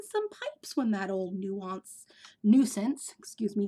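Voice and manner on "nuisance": ordinary delivery, nothing marked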